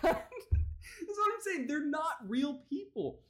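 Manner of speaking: high, slowed by laughter; laughing exasperation. Real, not acted. Host calling politicians fake people, high laughing exasperation. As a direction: squeeze the words out through laughter.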